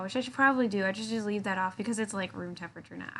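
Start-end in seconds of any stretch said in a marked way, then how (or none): none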